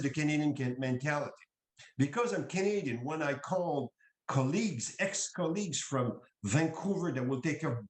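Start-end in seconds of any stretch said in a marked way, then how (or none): none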